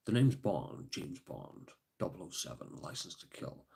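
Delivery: low, hushed Bond impression; Sean Connery. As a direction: murmur it like a suave secret agent.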